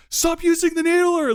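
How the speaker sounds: high, loud yell from upstairs. Retold or imitated. Friends upstairs yelling at players downstairs, yelling. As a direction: imitate a player shouting through the house. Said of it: "people from upstairs yelling down".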